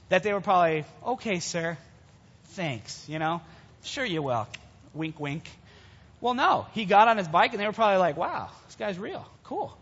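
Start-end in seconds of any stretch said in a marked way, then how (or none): 1.0-5.5 low, softened polite doubt; humoring disbelief. Acted. Imagined doubters humoring an old cyclist. 8.2-9.8 hushed, slow amazement; mock awe. Imagined doubters now impressed by cyclist.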